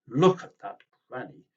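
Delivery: loud scandalised call; tutting passer-by. Acted. Passer-by scolding Tim's granny in the street, loud and scandalised. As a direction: point and tut loudly.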